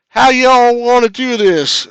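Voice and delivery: loud, high, slow Texan drawl; hammed-up Texan accent, vowels stretched; attempted Southern accent. Shown as a demonstration. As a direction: overdo a Southern accent until it turns Texan. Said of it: "That's still Texas".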